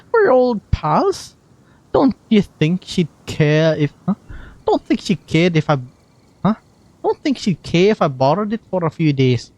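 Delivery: lightly Scottish Venti voice, playful; a gentle Scottish lilt as the bard; Scottish accent. Acted. Streamer voicing Venti's lines, in a light Scottish accent. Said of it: "Scottish Venti".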